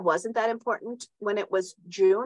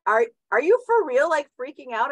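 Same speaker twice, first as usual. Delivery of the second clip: incredulous; 6 semitones higher, 5 dB louder than usual. Retold or imitated. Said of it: "he's like".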